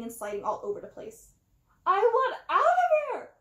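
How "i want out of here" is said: much higher and louder furious cry; angry high shout; fuming. A narrator's reading. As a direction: yell it in a fury. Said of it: "a rodent fumed".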